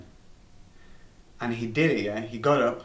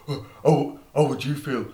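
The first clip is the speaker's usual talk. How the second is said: indignant friend. Retold or imitated. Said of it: "Zhivchik was like".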